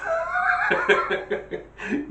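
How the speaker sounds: high burst of laughter at his own joke. Real, not acted. Comedian laughing at his own joke, a high laugh. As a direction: crack up at the punchline.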